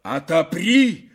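loud, high, drawn-out order; forceful stretched shout; shouted demand. A narrator's reading. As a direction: yell it while shoving at the door. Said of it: "повторил князь, напирая дверь".

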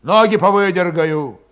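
menacing threat. A narrator's reading. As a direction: promise harm. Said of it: "недобрым голосом пообещал".

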